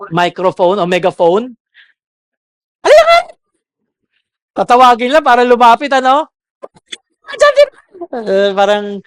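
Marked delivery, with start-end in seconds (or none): 2.8-3.4 very high, loud call across the house; megaphone yell. Retold or imitated. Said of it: "parang meron kayong ... megaphone". 4.6-6.3 shouted call. Retold or imitated. Mocking yelling parent.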